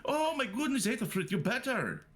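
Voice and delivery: high, delighted cry of relief; a big happy gasp of welcome; excited greeting. Acted. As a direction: gush with surprised joy at the recovery. Character greets healed companion.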